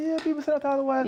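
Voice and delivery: high, fast mock voice; high quoted voice. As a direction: squeak it out quickly. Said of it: "I say".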